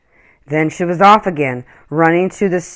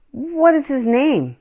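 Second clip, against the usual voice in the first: put-on higher, slow boy's question; wondering boy; 11 semitones higher than usual. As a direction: ask slowly in awe like a child. Boy asks mother softly.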